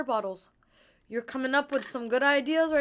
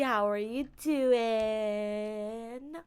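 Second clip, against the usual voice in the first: very slow stretched how are you; drawn-out greeting; much slower than usual. Acted. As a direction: stretch it out playfully.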